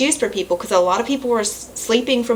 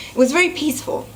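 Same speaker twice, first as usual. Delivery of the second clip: tearful; 6 semitones higher, faster than usual. Real, not acted. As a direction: let the tears sit in the voice. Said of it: "I'm misting".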